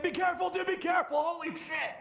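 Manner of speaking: high, urgent shouting; alarmed warning. Real, not acted. Warning a teammate of danger in game, alarmed shout. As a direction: cry out a sudden warning.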